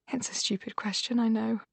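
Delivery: ordinary delivery, nothing marked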